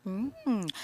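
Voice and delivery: ordinary talk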